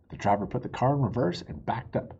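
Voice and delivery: usual speaking voice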